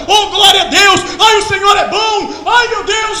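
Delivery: high, loud joyful cries; ecstatic praise. Acted. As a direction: shout praise like a rejoicing crowd. Joyful crowd exclaiming praise.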